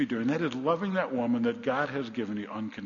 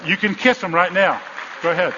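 raised, higher call to the crowd; loud urging; 5 semitones higher, 9 dB louder than usual. Real, not acted. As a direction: give loud permission, egging them on. Urging couples to kiss.

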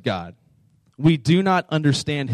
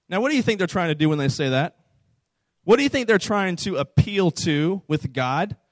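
ordinary talk

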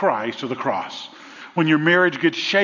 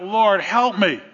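higher mock scream for help; 5 semitones higher, 3 dB louder, slower than usual. Dangling man begs the Lord, higher mock scream. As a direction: plead up to the Lord, half acted. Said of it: "He screams out".

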